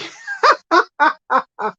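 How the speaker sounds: a burst of high laughter at herself. Real, not acted. Caught out on the accent.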